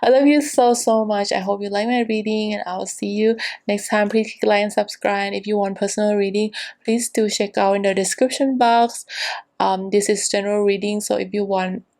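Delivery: usual speaking voice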